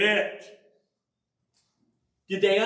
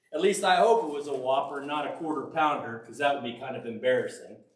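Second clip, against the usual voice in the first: quick joking aside; 9 semitones lower, faster than usual. Real, not acted. Joking aside about the Whopper.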